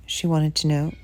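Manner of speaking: ordinary delivery, nothing marked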